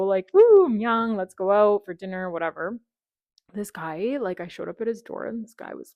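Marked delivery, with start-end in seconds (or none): 0.3-2.3 excited. Retold or imitated. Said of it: "I was so".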